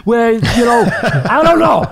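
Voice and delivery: high, teasing mock-innocent rising to shouts; joking mock suspicion. Teasing British fans about the crumpets, high mock-innocent jokey voice. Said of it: "I'm just kidding".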